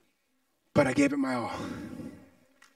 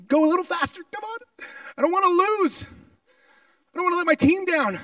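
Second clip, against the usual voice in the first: much higher, straining plea; desperate plea; 9 semitones higher than usual. Retold or imitated. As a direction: beg yourself not to lose. Pleading while running.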